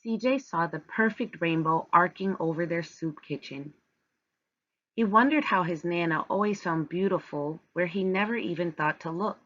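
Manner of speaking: ordinary delivery, nothing marked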